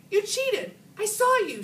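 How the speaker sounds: high loud accusing yell; girl furious at best friend, yelling; angry yell. A narrator's reading. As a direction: yell it at your cheating friend. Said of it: "she yelled".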